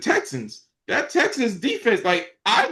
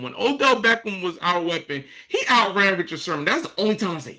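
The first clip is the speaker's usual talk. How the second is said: usual speaking voice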